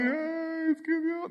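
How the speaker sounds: high, breathy fake crying over God; mock weepy wail. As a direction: ham up a sad worship song. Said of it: "canción triste en la que todos están llorando".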